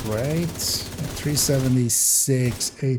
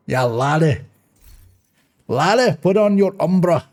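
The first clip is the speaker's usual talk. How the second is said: mock Scottish brogue, higher and louder; Scottish accent; 5 semitones higher, 5 dB louder than usual. Gamer jokingly hamming up a Scottish accent for viewers. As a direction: play a hammy Scottish laddie. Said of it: "a Scottish accent".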